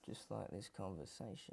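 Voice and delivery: very quiet, low dig; low grumbled aside to yourself; muttered aside. Acted. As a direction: drop it under your breath. Host's snide aside about the interview.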